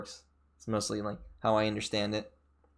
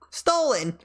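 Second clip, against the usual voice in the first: surprised; 11 semitones higher, 3 dB louder than usual. Real, not acted. Gasping at the stolen dog.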